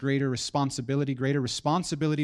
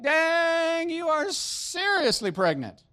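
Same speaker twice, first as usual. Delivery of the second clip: much higher, slow mock-amazed tease; teasing husband; 14 semitones higher, 4 dB louder, much slower than usual. Husband teasing his pregnant wife, mock amazed. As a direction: gasp at her in exaggerated awe. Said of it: "I would be like".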